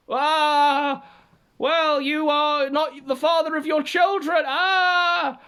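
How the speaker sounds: high, loud hammed-up shriek; mock screaming rant. Mocking Ralph screaming down the phone at Matt. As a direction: yell it like a childish tantrum. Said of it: "Ralph goes crazy".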